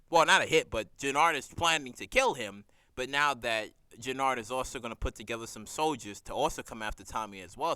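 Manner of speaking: usual speaking voice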